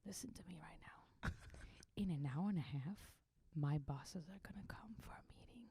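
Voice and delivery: tense whispered warning; commander whispering. Retold or imitated. Commander whispering a threat to his soldier. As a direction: hiss it close to the ear. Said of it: "He comes, like, this close to me".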